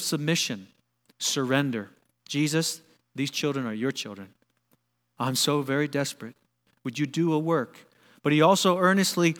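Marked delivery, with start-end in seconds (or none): 2.3-7.9 low, hushed earnest plea; desperate pleading. Acted. Desperate father pleading with Jesus for his children, hushed earnest plea. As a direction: beg softly, voice dropped in prayer. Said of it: "There's a desperation in his voice".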